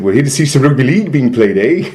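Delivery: put-on thick Canadian accent, ending on eh. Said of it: "really thick Canadian accent".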